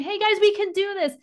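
high-pitched pep talk; rally the crowd brightly, voice lifted well up; upbeat pastor cheering people on; encouraging. Acted. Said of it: "They're giving an encouraging message".

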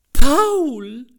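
high, loud shriek of a name; shrieking mother. A narrator's reading. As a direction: scream the boy's name in horror. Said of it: "schrie die Mama". (schriller Schrei der Mutter)

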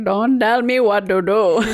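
amused put-on Indian accent; mock Indian accent, Ds replacing Ts. Shown as a demonstration. Recalling the funny skit for a friend, imitating an Indian accent. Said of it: "That's a total accent".

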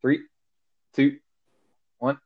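ordinary talk, nothing marked

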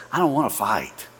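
a hint of a soft conciliatory plea; gentle heart. Shown as a demonstration. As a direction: speak to calm the fight. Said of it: "reveals this heart that says".

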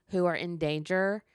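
normal talking voice

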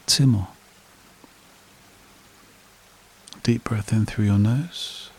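slow, calm meditation voice with long pauses; guided meditation. Acted. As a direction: lead a breathing exercise, unhurried, leaving long silences.

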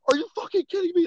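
retold high-pitched disbelief; indignant girl. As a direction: imitate an outraged girl in a high voice. Retold outraged girl storming out.

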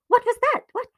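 high, loud, alarmed questioning; frightened, high-pitched outburst; startled alarm. Acted. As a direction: cry out in sudden fright. Aunt startled by cough in darkness.

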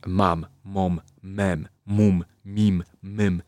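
"mam, mom, mem, mum, mim, mym" mit betontem M gesprochen; M-Silbenübung. Shown as a demonstration. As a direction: jede Silbe deutlich mit geschlossenen Lippen auf M. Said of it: "Ćwiczymy głoskę M i powtarzam sobie".

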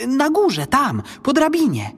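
put-on high, urgent boy giving directions; boy's voice. A narrator's reading. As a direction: point the way as an excited boy. Boy directs goblin during fight.

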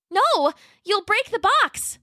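high, slow alarmed little T-Rex; Roxy, alarmed. Acted. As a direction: stop him, high and worried. Roxy forbids.

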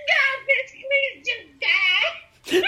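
reenacting Brenda yelling at the fallen girl, hammed-up; mock yell. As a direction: shriek it, drawn out, half laughing. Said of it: "Brenda's like".

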